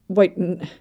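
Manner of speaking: mildly incredulous, cutting off mid-word; stammered, understated disbelief. A narrator's reading. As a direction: start to object in disbelief, then break off. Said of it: "Kim Dokja said in incredulity".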